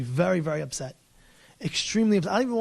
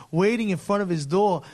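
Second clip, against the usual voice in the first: usual speaking voice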